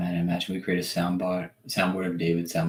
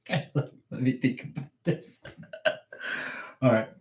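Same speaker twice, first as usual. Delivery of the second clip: ordinary delivery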